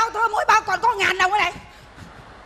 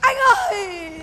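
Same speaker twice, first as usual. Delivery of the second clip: laughing wail; 5 semitones higher, 7 dB louder, slower than usual. Acted. Wailing, laughing through it.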